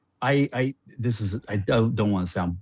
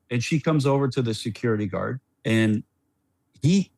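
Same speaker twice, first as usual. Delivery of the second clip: ordinary talk, nothing marked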